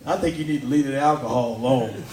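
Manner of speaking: wife dismissing her husband, low; deadpan wife. Acted. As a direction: shut him down low and flat. Wife voiced in the bit.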